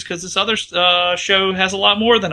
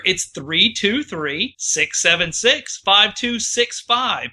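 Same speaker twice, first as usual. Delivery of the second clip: slow and clear; slower than usual. Shown as a demonstration. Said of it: "here's the number".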